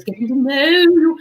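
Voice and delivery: put-on lilting old-fashioned English accent; British stage accent, stretched final vowel; Julie Andrews. Shown as a demonstration. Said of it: "it's a little bit like Julie Andrews".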